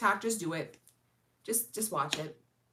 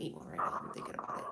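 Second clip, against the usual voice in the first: whisper; 11 semitones lower, 9 dB quieter, faster than usual. Real, not acted. Aside about eating a cookie now.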